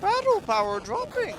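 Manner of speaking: a haughty machine monarch who lost but still gloats; robot queen voice. Acted.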